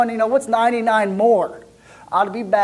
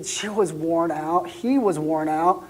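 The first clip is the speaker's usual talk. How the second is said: usual speaking voice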